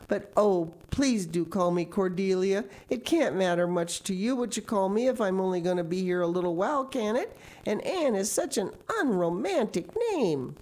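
put-on pleading girl. A narrator's reading. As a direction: coax her to agree. Anne pleads.

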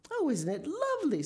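high, gushing pleasure; thrilled, high-pitched exclamation; delighted admiration. Acted. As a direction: coo with delight at a new home. Mother admires the finished nest.

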